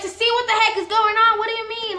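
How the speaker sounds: high, loud, exasperated comeback; indignant retort, voice shooting up. Retold or imitated. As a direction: snap back in high-pitched disbelief. Acted exasperated reply in retold argument.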